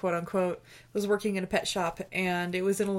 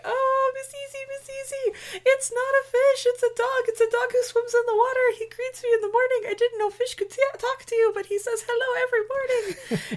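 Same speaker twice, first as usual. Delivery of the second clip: put-on much higher, delighted African-accented voice; African accent imitation; 3 dB louder than usual. Guard gushing to the pet shop girl about his fish, African accent. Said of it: "I'm not gonna do his accent any justice".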